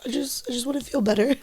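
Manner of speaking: quick, high, soft whisper near tears; pleading through held-back tears; tearful whisper. Real, not acted.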